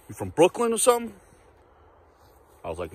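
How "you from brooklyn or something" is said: higher, mock-tough street voice asking where he's from; imitating a street guy. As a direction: put on the stranger's challenging voice. Retells a street guy questioning him.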